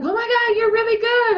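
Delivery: high-pitched imitated delight; delighted wife. Wife praising her technique, high and delighted.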